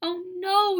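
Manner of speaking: high, loud alarmed cry; screamed. A narrator's reading. Girl seeing the missing teeth, high alarmed cry. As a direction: shriek in horror. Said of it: "Sheila screamed".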